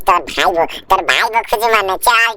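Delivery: ordinary talk, nothing marked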